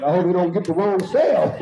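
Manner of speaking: nervous laugh about the wrong cell; laughing worry. Retold or imitated. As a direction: inmate one cell over, laughing nervously. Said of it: "I said".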